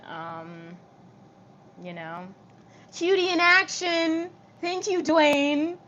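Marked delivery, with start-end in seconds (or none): 2.9-5.8 high, loud and drawn out; excited shout-out. Real, not acted. Streamer thanks a viewer by name, excited drawn-out shout-out. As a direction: call it out slowly, high and loud.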